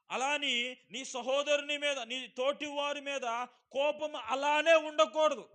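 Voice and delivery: loud, slow, high proclaiming of scripture; full force preaching. Acted. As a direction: thunder the verse at the congregation. (గట్టిగా నెమ్మదిగా ప్రసంగం)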